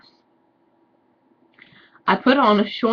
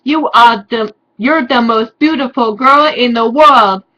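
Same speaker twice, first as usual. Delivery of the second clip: hammed-up Draco; 4 semitones higher, 7 dB louder than usual. Draco's declaration.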